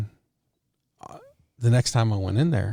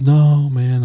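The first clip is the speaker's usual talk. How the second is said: louder, higher objection; retold protest; 4 semitones higher, 7 dB louder than usual. Cook refusing to leave the Waffle House, retold protest. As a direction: push back emphatically, quoting him.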